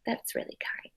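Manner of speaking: soft approving whisper; gentle hushed aside. Real, not acted. Aside to children during story.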